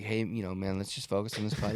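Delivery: gently steer him back to the task; retold calming caddy. Caddy to upset golfer before a putt, softly placating. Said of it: "his caddy's like".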